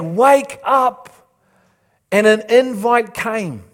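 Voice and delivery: loud, high, insistent sermon voice; impassioned preaching. Real, not acted. Preacher retelling God's warning to wake up, urgent and forceful. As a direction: drive each warning home with rising force.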